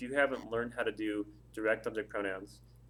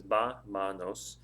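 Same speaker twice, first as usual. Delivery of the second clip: "ba, manos" broken up, first syllable strongest; split into syllables; 2 semitones lower, much slower than usual. Shown as a demonstration. Teacher counting out syllables for learners, "ba, manos" said in separate pieces. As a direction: separate the "ba" firmly, then let "manos" drop. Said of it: "It's three syllables".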